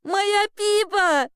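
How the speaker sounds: put-on high, drawn-out girlish rapture; delighted cry. A narrator's reading. Girl reunited with her cat, delighted. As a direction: stretch it out high and overjoyed. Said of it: "восторженно закричала Элли".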